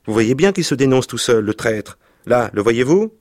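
accusing shout. Acted. Lebrac accusing Baccaillé before his troops, loud and high. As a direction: call out the traitor to the whole gang.

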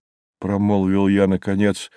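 usual speaking voice

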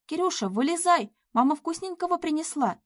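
high, lilting call to a pet; coaxing call. A narrator's reading. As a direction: coax a cat out of hiding. Coaxing the hidden cat out.

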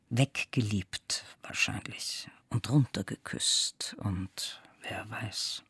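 trailing into a slow whisper; whispered afterthought, slowing at the end; hushed musing. A narrator's reading. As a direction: let it sink to a soft, slow whisper. Narrator muses on lost make-up.

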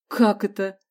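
usual speaking voice

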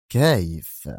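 ordinary delivery, nothing marked

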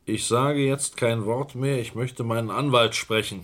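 angry outburst. Acted. Chapman cornered by the inspector, refusing angrily and loudly. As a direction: slam the door on the questioning. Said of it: "Beruhigen Sie sich".

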